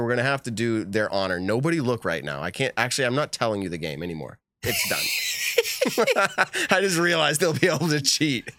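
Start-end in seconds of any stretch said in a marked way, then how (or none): 4.6-8.5 high-pitched, breaking up with laughter; laughing. Real, not acted. Host realizing players will be able to cheat, laughing. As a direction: laugh helplessly through the words. Laughs at own spoiled game.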